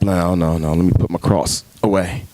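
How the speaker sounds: timid backing off. Retold or imitated. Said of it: "we kinda back up".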